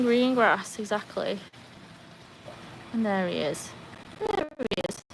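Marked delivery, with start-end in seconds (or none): 4.2-5.0 high, soft cooing to the dog; dog talk. Real, not acted. Owner softly cooing as her dog appears. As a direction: coo at the dog sweetly.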